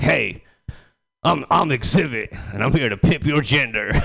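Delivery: lightly voiced TV host introduction; Exhibit. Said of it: "the exhibit be like".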